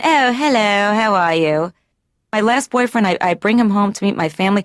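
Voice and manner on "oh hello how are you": a light mock British accent. Patient mocking family greeting her boyfriend in a British accent. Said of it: "they all of a sudden turn British".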